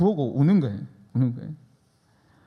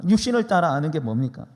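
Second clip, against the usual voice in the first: usual speaking voice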